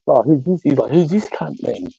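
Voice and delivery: high-pitched retold challenge; mock suspicious question, pitched high; imitating nosy cousin. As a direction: play the cousin sizing up a stranger, voice up. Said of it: "And then he's like".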